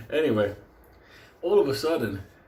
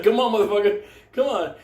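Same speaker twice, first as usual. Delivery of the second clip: higher, louder goading call; 8 semitones higher, 5 dB louder than usual. Retold or imitated. Friend goads the dog into the belt loop, acted goading. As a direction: egg it on in a raised voice.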